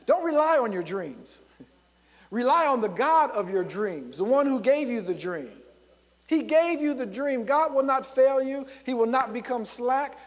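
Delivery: ordinary delivery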